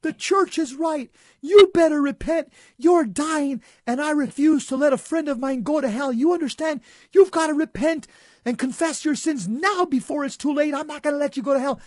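fierce retold rebuke, pleading for repentance; yelling back. Young man yells at dying friend to repent, retold yelling. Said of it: "I began yelling back at him".

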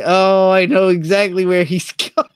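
usual speaking voice

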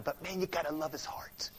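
ordinary talk

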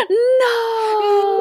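long, high overacted wail; Calculon. Host demonstrates a character that overacts, melodramatic wail. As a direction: cry out in over-the-top soap opera agony. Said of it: "is a character that overacts".

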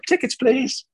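high, mock-official ticket inspector voice; conductor's request. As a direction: chirp it like a train conductor.

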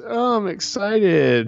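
put-on Kardashian drawl, high-pitched. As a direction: mimic a reality star feigning excitement, drawn out. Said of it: "Just kind of this, like, Kardashian".

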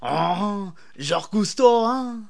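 high, hammed-up French accent; mock French accent. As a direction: parody French accent mocking his own Jacques Cousteau. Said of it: "the French accent like you".

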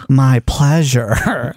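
slow, drawn-out "my pleasure"; Chick-fil-A worker voice. Retold or imitated. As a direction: stretch it out sweetly. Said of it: "They're just like".

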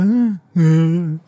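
lightly voiced clueless grunt; a hint of dim, wordless puzzlement; Harry. Harry missing what Hermione suspects at once, lightly imitated cluelessness. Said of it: "E o Harry fica".

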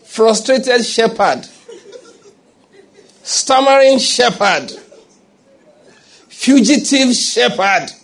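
high, slow pulpit proclamation; drawn-out, ringing declamation; emphatic preaching. Real, not acted. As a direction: hammer out each title slowly, pitched high. Preacher hammers out epithets.

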